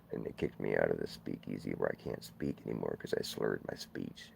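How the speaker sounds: low woeful telling of the blown spokesman; sad storyteller. Acted. Mournful low voice for the sad tale.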